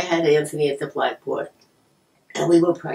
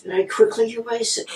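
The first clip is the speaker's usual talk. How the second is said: ordinary talk, nothing marked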